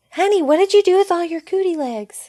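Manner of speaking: high-pitched mock mom, calling to her kid; imitating a mom. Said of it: "and the mom was like".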